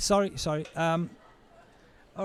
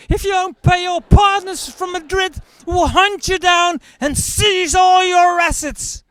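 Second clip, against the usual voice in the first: menacing bailiff shout; 13 semitones higher, 9 dB louder than usual. Acted. Debt collectors threatening the broke narrator, mock bellow. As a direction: bark it like a cartoon heavy.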